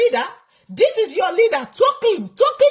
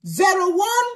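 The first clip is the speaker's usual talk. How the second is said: ordinary delivery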